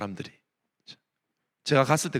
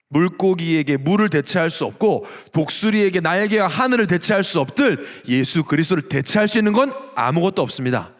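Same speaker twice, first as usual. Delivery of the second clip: ordinary delivery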